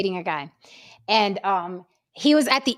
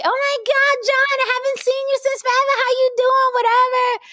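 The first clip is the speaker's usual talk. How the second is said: much higher, fast, sing-song squeal; mock the woman who runs up; high excited old-friend greeting; gushing woman. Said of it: "I heard the bitch say".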